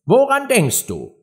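high, demanding mouse voice; stern. A narrator's reading. Mouse questions an inattentive Alice, stern. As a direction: question it like a reprimand. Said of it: "sagte die Maus streng".

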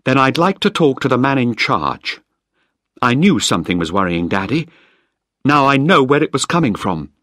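Penny coldly demanding the man in charge; cold and accusing. Acted. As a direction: chill toward him, then a flare. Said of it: "She looked at me coldly".

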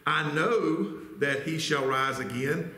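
"know" drawn out, rising and stressed; slow down and weigh "know". Shown as a demonstration. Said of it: "See that word, know?".

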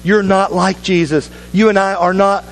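ordinary talk

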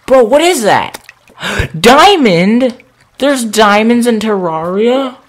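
high, loud astonished disbelief; can't believe your eyes, shout it up high; amazed. Real, not acted. Finds diamonds in cave.

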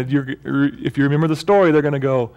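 normal talking voice

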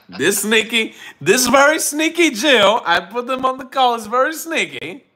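high, teasing mock accusation; teasing sneaky. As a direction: poke fun at the guest's sneakiness.

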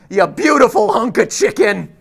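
hammed-up praise, belted out; mock adoration. Gushing mock-lovingly at Chica.

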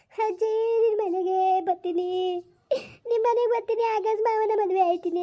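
put-on grieving mother lightly echoing her lost daughter's childish plea; imitating her little daughter. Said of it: "ಅಂತಿದ್ಲಲ್ಲವ ನನ್ ಮಗಳು".

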